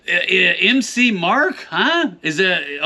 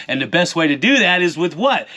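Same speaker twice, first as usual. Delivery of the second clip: ordinary talk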